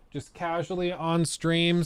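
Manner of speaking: ordinary talk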